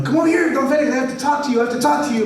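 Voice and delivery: high, loud, hurried plea; urgent neighbour. Retold or imitated. Nacho urging grandpa to come talk, high and hurried. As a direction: press him to come over now.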